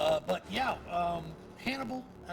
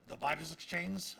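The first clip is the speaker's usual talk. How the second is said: ordinary talk, nothing marked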